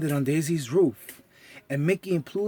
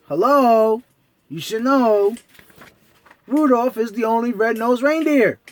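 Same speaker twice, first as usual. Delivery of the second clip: character voice; 5 semitones higher, 6 dB louder than usual. Acted. Character answering Mickey.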